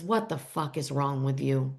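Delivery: low, soft retold reproach; mock upset. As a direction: say it low and subdued, without tears. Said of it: "Calla starts crying".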